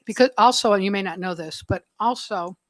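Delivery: talking while crunching food. Real, not acted. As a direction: speak through a crunchy mouthful. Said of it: "sorry, I'm crunching".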